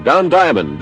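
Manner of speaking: ordinary talk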